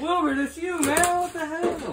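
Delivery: high excited call; excited greeting. Acted. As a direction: shout his name in delight. Excited recognition, high and far.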